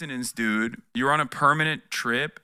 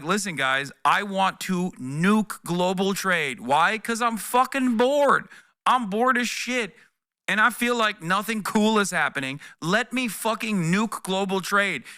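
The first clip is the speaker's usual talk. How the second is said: mocking Trump; 3 semitones higher, slower than usual. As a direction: drawl it out like a spoiled bored president. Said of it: "Donald Trump came out and was like".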